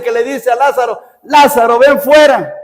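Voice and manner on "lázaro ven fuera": higher, louder summoning cry; loud call to Lazarus. Acted. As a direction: call him out of the tomb. Jesús llama a Lázaro. (grito alto llamando a Lázaro)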